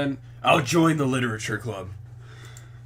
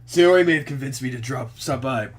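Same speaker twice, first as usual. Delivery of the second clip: ordinary talk, nothing marked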